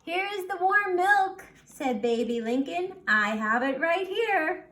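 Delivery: high, loud eager offer; eager sister. Acted. Baby brings the warm milk to the Watsons, high and eager. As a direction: hurry in proudly with the milk.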